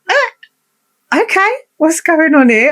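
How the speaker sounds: high, startled squeak of confusion; a baffled little shriek, caught off guard; surprised squeak. Retold or imitated. Flustered woman reacting to his flirting, high surprised squeak.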